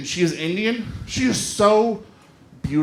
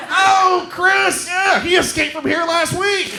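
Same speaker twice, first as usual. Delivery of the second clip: mock prison officer; 8 semitones higher, slower than usual. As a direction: play a cheerful jailer on the phone. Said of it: "She'd be like".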